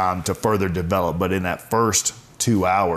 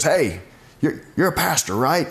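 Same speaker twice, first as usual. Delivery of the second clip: lightly voiced neighbor, pitched higher, asking; a hint of a friendly neighbor stopping you on a walk; curious neighbor; 7 semitones higher than usual. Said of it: "she goes".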